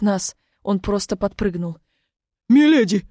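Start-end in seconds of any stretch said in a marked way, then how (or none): 2.5-3.1 jumpy, loud guard; startled cry of a guard. A narrator's reading.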